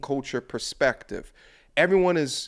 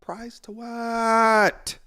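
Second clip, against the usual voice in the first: slow, drawn-out disbelief, higher; incredulous; 8 semitones higher, 5 dB louder, much slower than usual. Real, not acted. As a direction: stretch it out in disbelief. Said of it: "repeated question, drawn out".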